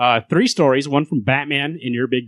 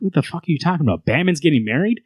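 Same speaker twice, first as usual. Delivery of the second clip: incredulous hostage; 6 semitones higher, faster than usual. Retold or imitated. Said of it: "And the guy's like".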